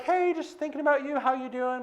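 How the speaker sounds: lightly voiced high, breezy hello; high-pitched mock phone greeting; imitated casual friend. Friend's casual phone call, retold.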